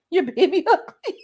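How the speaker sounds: giggling blunt verdict; laughing punchline. Real, not acted. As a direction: crack up while delivering the joke.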